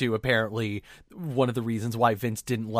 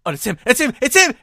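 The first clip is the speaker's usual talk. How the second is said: rising higher and louder each time; building excitement; 13 semitones higher, 9 dB louder, faster than usual. Retold or imitated. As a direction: build from recognition to a thrilled cry. Fan recognizing wrestler, building.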